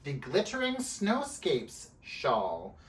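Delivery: slow and deliberate, word by word; slow, careful. Shown as a demonstration. Knitter showing how she has to say the shawl's name, slowly. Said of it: "say it slowly like that".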